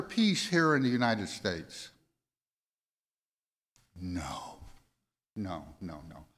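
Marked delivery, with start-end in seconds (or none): none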